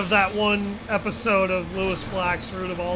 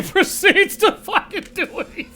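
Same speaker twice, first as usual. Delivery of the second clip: usual speaking voice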